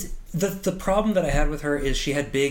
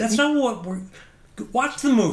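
higher-pitched, exasperated retort; mock exasperation; 8 semitones higher than usual. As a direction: imitate an actor brushing off the complaints. Acting actor's exasperated reply to critics.

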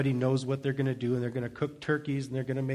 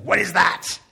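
loud disgust; 4 semitones higher, 7 dB louder than usual. Retold or imitated. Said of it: "and she's like".

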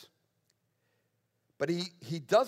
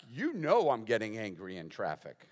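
lower, falling acted answer; emphatic admission; 3 semitones lower than usual. Preacher answering the imagined skeptic, emphatic admission. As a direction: own up firmly, leaning on YOU KNOW.

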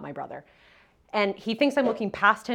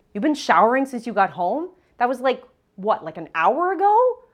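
incredulous; 5 semitones higher, 9 dB louder than usual. Retold or imitated. Ex incredulous at long shower.

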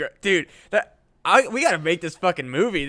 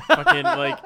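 boogeyman; 12 semitones higher, 7 dB louder, slower than usual. Retold or imitated. Boogeyman voice, high and loud.

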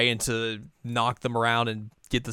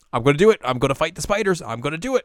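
louder, eager self-pep talk; pumped up; 7 semitones higher, 5 dB louder, faster than usual. Retold or imitated. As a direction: psych yourself up. Shippo psyching himself up.

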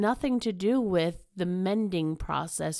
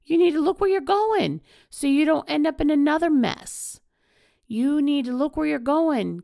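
louder, higher push to look ahead; insistent pep-talk push, raised voice; emphatic urging; 7 semitones higher, 5 dB louder, faster than usual. Real, not acted. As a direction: drive it home like a coach. Urging listeners forward.

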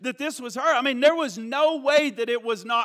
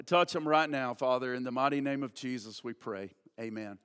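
hushed congregational prayer, pitched down; spoken prayer; 6 semitones lower than usual. Real, not acted. Closing prayer before the sermon.

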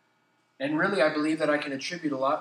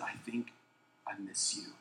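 quiet confession; 7 semitones lower, 14 dB quieter than usual. Retold or imitated. Tender confession at three AM.

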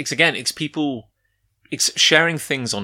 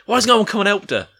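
high, loud call into the tent, quick at the end; mock paramedic shouting to find the injured woman; 9 semitones higher, 3 dB louder, faster than usual.

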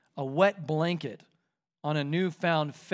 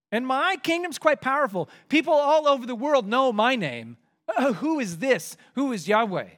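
higher, louder mock boast; boastful Pharaoh; 6 semitones higher, 4 dB louder than usual. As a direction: swagger as a proud king. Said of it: "Pharaoh says".

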